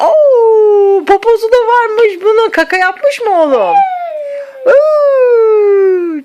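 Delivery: high, loud, delighted cooing. Real, not acted. Grandma delighted at baby.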